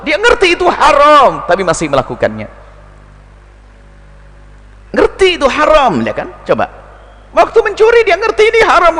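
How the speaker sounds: high, forceful preaching cry; fiery preaching. Acted. Preacher warning that reason alone fails, fiery and high. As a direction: drive the point home to the congregation.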